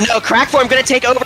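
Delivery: high, urgent caster call; excited play-by-play. Real, not acted. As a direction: call the sudden takeover with excitement. Climax of live match call.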